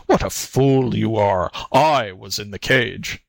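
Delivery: weighty, scornful tiger voice; impatient beast correcting a fool; exasperated tiger. A narrator's reading. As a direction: cut in with contempt. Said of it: "interrupted the tiger".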